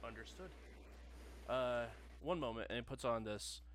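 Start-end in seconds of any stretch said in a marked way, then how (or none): 0.0-2.7 customer service voice. Acted. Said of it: "familiar customer service voice".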